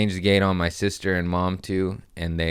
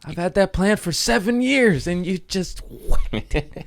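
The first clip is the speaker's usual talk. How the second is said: outraged at cat; 10 semitones higher than usual. Retold or imitated. Owner to cat that wrecked plant, indignant scold. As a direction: tell the cat off in disbelief. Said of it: "But I'm also like".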